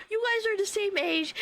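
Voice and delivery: high-pitched, amused re-quote of embarrassed brother; embarrassed retelling. Retold or imitated. Streamer re-quoting brother's awkward remark, embarrassed amusement. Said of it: "my brother embarrassedly goes".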